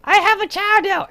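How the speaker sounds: very high cutesy creature voice; Yukuri voice. Retold or imitated. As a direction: pipe it up like a proud little blob creature. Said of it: "the Yukuri was like".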